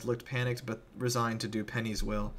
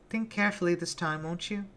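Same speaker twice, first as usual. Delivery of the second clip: put-on a sadistic girl toying with her victim; Penny taunting Billy before the crowd; Penny's mock-sweet voice; 8 semitones higher than usual.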